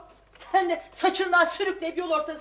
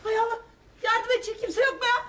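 panicked cry for help; 7 semitones higher than usual. Acted. Yardım çağırma, panik.

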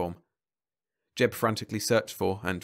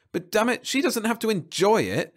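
savage complaint; 12 semitones higher, 5 dB louder than usual. A narrator's reading. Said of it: "Jeb complained savagely".

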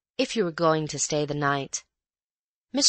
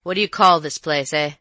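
louder gruff colonial voice; rough colonial; 7 dB louder than usual. Acted. Rough visitor quizzing the maid, loud and gruff. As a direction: question her roughly.

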